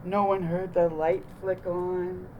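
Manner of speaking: usual speaking voice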